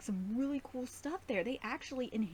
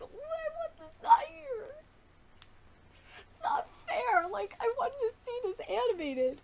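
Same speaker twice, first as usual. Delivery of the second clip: whining complaint; 14 semitones higher, 6 dB louder than usual. Real, not acted. Fan upset over cut scene.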